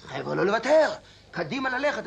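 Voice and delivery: rousing retold commander's yell; Bushtina. Veteran imitating his commander rallying the men, shouting. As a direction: urge the troops on, voice climbing. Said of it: "בושטינה היה צועק".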